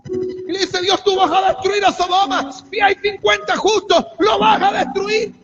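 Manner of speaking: full-force preaching. Real, not acted. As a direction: preach it at the top of your voice. (prédica a gritos, con toda la fuerza)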